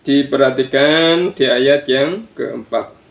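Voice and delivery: ordinary talk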